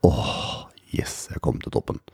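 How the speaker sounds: breathy whisper after a long sigh; exhausted, whispered little victory; whispered relief. Retold or imitated. Relief on reaching the top.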